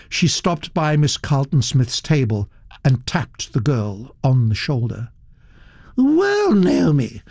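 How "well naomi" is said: high, grand greeting with falling name; Duchess greeting. Acted. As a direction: call the girl like an old duchess.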